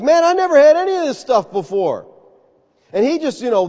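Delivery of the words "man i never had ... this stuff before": much higher, louder, thrilled; excited. Acted. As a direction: gush over the food like a kid. Excited Peter at the feast.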